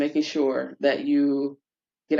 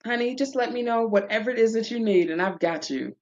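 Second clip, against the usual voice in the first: higher, sweet caring voice; mock-sweet attendant promise; sweet reassuring flight attendant; 8 semitones higher than usual. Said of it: "I said".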